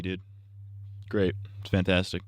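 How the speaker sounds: normal talking voice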